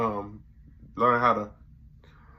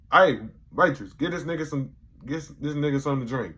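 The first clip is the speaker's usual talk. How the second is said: loud call across the room, then quick; calling the waitress; 5 semitones higher, 6 dB louder than usual. Retold or imitated. Dave calling the waitress over for the guy, loud. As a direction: holler for the waitress, then rush the rest. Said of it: "And he's like".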